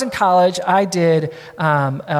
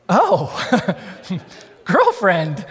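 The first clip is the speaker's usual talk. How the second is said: put-on delighted "Oh, girlfriend" with a little laugh; light pleased laugh on girlfriend; much slower than usual. Colleen pleased at being called girlfriend, laughing lightly. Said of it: "Colleen's standing there going".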